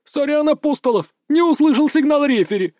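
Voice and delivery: high, sneering mock apology; snide mocking villain. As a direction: taunt him with a smirk. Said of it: "ехидно рассмеялся этот урод".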